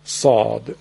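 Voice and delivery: صاد said deep and full from the throat; weight the ص; heavy throaty saad. Shown as a demonstration. Teacher summing up the letter for listeners. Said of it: "این شد حرف".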